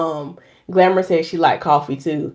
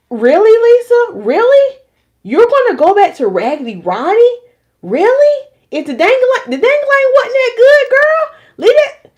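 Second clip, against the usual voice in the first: sassy self talk; 5 dB louder than usual. Retold or imitated. Lisa scolding herself about Raggedy Ronnie, high sassy voice. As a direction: scold yourself like a sassy best friend. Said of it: "I had to really talk to myself".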